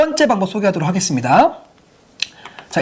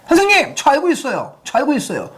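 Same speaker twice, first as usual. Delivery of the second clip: eager schoolgirl; 6 semitones higher than usual. Retold or imitated. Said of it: "순수한 여학생이".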